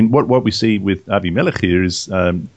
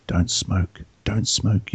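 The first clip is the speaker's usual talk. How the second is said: very soft, hushed subliminal tape voice; imitated whispering; 3 semitones lower, 8 dB quieter, much slower than usual. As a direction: murmur it softly, like a message played to a sleeper. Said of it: "starts whispering to you".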